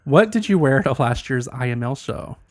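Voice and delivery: ordinary delivery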